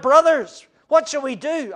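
loud high distressed cry. Acted. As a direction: cry out as a stricken crowd. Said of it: "cried out".

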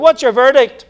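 loud, high-pitched theatrical challenge; histrionic demand. Retold or imitated. High priest demanding the court's verdict on Jesus, histrionic. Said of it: "histrionic demonstration".

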